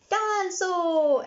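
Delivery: put-on high, slow, loud little boy; toddler George. A narrator's reading. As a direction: shout it out like a toddler. Toddler George adding dinosaur.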